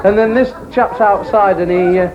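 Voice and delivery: ordinary delivery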